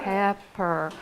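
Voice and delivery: "Pepper" in two separate beats, pep, per; split into syllables. Shown as a demonstration. Teacher showing the class two syllables, "Pepper" split as pep, per. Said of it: "What are those two syllables?".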